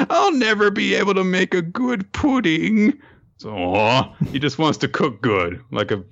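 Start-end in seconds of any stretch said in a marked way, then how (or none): 0.1-2.9 a high, put-on sulky voice; lightly hammed pity-party whine; mopey foodie character. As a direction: lament it like a tragic cook. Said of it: "Naputuku's like".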